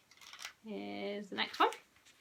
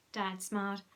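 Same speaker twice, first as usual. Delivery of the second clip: ordinary delivery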